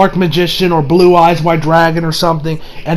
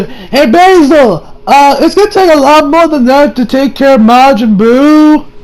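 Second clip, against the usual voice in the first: higher, slow, hero challenge; confident Goku; 7 semitones higher, 4 dB louder, slower than usual. Retold or imitated. Goku urging Basil to fight harder, slow and higher. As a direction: egg him on like a cheerful hero. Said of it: "Goku tells".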